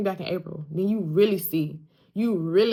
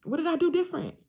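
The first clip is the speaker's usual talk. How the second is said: pitched-up puzzled question; mock bewilderment; 10 semitones higher, faster than usual. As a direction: act baffled, high and light. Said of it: "And I'm like".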